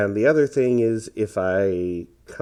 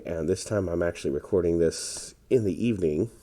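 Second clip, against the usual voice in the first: ordinary delivery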